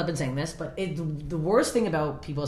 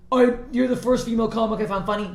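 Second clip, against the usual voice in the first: high, louder mocking quote; mimicked fan message; 6 semitones higher, 5 dB louder than usual. Comic quoting people who message her, mocking. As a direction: parody the backhanded compliment. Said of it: "anyone who D Ms me".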